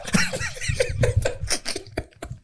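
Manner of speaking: wheezing high laugh; high breathy laugh. Real, not acted. Laughing at own joke.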